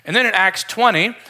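usual speaking voice

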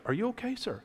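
high, questioning check on a passenger; imitated concerned lady. Lady checks on tearful airline passenger, lightly imitated concern. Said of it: "the lady comes by and she's like".

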